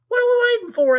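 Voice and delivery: very high, loud eager question; Sammy asking. Acted. Sammy asking the children in line. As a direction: ask it like an eager little seal. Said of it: "asked Sammy".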